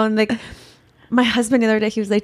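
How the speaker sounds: ordinary delivery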